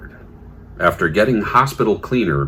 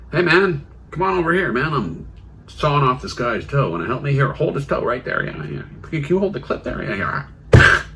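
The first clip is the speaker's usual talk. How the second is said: tag; 7 semitones higher than usual. Acted. Acting surgeon recruiting the cleaner.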